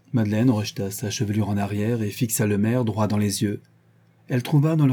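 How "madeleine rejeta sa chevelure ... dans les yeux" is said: usual speaking voice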